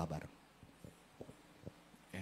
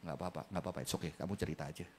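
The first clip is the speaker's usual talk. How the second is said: gentle reassurance; 13 dB quieter, faster than usual. Retold or imitated. Father reassuring child.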